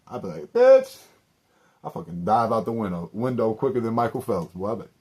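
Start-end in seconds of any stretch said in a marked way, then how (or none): 0.5-1.0 loud shocked shriek, pitched way up; shouted bitch. Pretend. Reacting to her jar scheme with a shocked shriek. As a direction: yelp it out in disgust.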